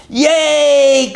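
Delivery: high, loud cheer; cheering sheep. Acted. Sheep cheering as they rush the cakes, high loud cheer. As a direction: cheer like hungry sheep rushing at cake. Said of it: "cried the sheep".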